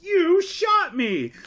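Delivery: high, loud, slow hammed-up yell; mock outraged shout. Guard reacts to getting shot, exaggerated slow yell. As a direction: stretch it out like a cartoon victim. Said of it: "responds to getting shot by being like".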